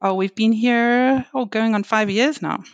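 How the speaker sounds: ordinary delivery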